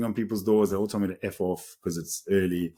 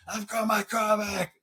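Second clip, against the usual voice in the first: much higher, slow, triumphant announcement; excited; 12 semitones higher, slower than usual. Retold or imitated. Running in to tell his father, high triumphant announcement. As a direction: crow it to the whole house.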